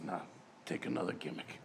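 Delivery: hushed low advice, nearly unvoiced; hushed quoted aside; low breathy aside. Curtis voiced, low aside.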